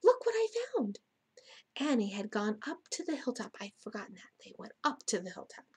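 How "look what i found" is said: high, louder eager call. A narrator's reading. Jack showing Annie his find, calling out high. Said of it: "Jack called".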